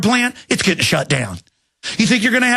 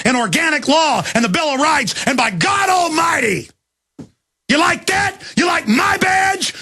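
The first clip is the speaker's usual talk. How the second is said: much higher, shouted defiance; furious shout; 6 semitones higher than usual. Real, not acted. Host yelling defiance at federal agents, furious shouting. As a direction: scream defiance at them.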